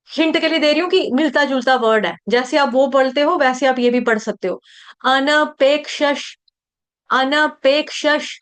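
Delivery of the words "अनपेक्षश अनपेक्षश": अनपेक्षश said slowly, syllable by syllable, with a clear क्ष cluster; slow model pronunciation. Shown as a demonstration. Teacher modelling a word for students, slow and careful. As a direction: stretch out अनपेक्षश carefully for the class. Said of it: "उच्चारण पे ध्यान देंगे".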